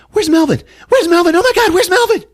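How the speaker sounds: high, loud panicked shriek; hammed-up panic, rising with each call; mock freak-out. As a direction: imitate a frantic mother, escalating. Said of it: "So she freaks out".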